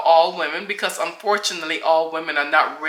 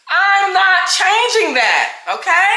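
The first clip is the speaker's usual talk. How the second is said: emphatic; 11 semitones higher, 3 dB louder, slower than usual. Real, not acted. Insisting on her loud voice.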